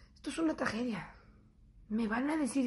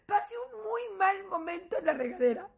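usual speaking voice

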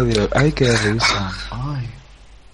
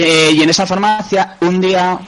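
ordinary talk

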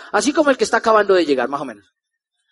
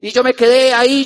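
ordinary talk, nothing marked

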